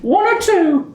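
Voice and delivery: a doting granny showing off her wares; high grandmotherly shopkeeper offering buns; sweet old baker lady. Acted. Tabitha serves T-Rex chops.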